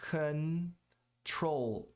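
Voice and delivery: "Control" stressed on TROLL, N and final L sounded; con-TROLL, pronounce the N; correct English control. Shown as a demonstration. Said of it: "Tem que pronunciar o N".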